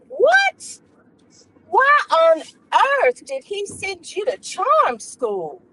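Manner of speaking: sudden high shocked What then a falling question; shocked gasp. Acted. Ladies at tea shocked by the charm school news, high gasp. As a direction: gasp in disbelief.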